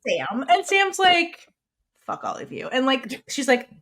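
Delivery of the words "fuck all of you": imitating a bitter exit line, low and clipped; mock angry growl. Sam, storming out on her friends, in a low mock snarl. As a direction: drop low and spit it out as a retold snarl.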